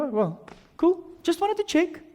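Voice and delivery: high, small, soft-edged voice; sheepish reply. Acted. Husband learns wife didn't call, sheepish reply. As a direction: back off lightly, high-pitched.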